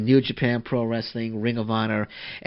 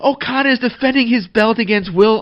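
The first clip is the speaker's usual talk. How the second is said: loud, high-pitched thrilled announcement; excited shout; 7 semitones higher, 7 dB louder than usual. Real, not acted. Podcaster blurting the match news while signing off, excitedly.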